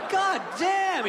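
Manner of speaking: high-pitched horrified exclamation; shocked outburst. Retold or imitated. Watching the lemur eat three crabs, shocked. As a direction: blurt it out, voice shooting up.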